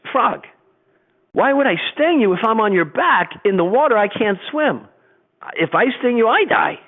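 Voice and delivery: the scorpion talking the frog round; persuasive scorpion. Acted. Scorpion voiced in fable.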